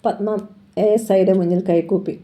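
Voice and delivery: ordinary delivery, nothing marked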